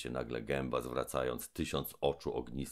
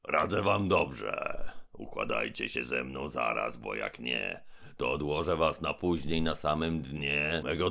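menacing giant mouth; 5 dB louder than usual. Acted. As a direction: boom down from above. Gębon bargains with threats.

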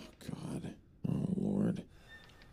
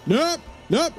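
shrieking nopes. Real, not acted. Streamer running from a monster, shrieking nopes. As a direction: squeal it in total panic.

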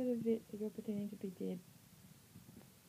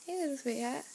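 ordinary talk, nothing marked

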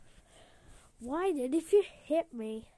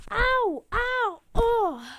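pained yelps; 9 semitones higher, 8 dB louder, much slower than usual. Acted. Getting hit.

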